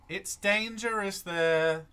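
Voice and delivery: high, loud and slow warning; a villager warning travellers, high and drawn out; [name]'s voice. Acted. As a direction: spell out the danger like a worried local. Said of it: "you hear [name] go".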